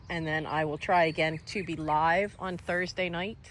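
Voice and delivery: ordinary delivery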